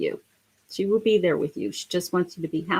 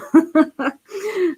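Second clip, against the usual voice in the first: laughter; 10 semitones higher, 4 dB louder than usual. Real, not acted. Laughs off her own tears.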